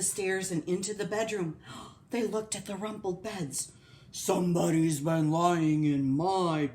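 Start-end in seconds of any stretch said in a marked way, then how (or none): 4.1-6.7 loud, slow, gruff growl; Papa Bear. Acted. Papa Bear finds his bed rumpled, gruff growl. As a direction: growl it slowly as a big bear. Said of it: "his great gruff growling voice".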